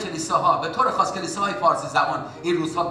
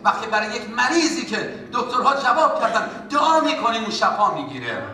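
fervent preaching; 6 semitones higher than usual. Acted. Preaches at full force.

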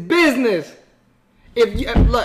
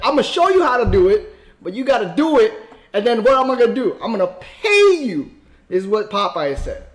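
usual speaking voice

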